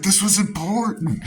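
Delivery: high, loud mock scolding as mom; imitated yell. Mom scolding her son about the ruined puzzle, high mock yell. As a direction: squeal it like an outraged mom. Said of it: "She starts vocally yelling at me".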